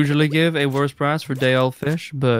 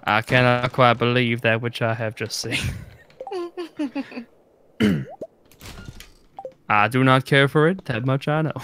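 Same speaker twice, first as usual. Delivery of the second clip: put-on southern accent. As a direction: play a formal Southerner, lightly. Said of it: "What, my southern accent?".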